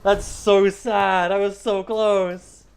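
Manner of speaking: slow drawn-out lament; dramatic slow wail. Real, not acted. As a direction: wail it out, very slowly. Lamenting missed kill.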